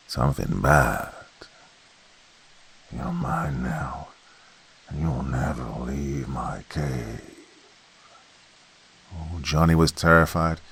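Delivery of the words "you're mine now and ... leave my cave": slow, much softer threatening voice; menacing monster. Acted. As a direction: claim your prey, drawn out. Cave monster taunting Johnny.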